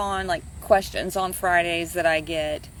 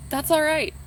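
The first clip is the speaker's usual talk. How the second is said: higher, gently reassuring; 8 semitones higher than usual. Shown as a demonstration. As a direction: comfort them, lifting the pitch.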